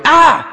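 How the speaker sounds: a high, loud yelp of fake surprise; mock startled yelp. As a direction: yelp like you just got shot by a toy. Grandpa hit by Nerf dart.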